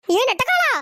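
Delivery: loud bellow of the nickname; angry shout. Acted. Wife shouting after her husband on the bike, angry. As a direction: holler at him across the road.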